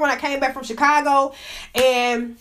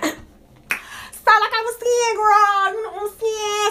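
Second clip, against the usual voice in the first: high-pitched excited; 7 semitones higher, much slower than usual. Real, not acted. High squealing girl talk opener.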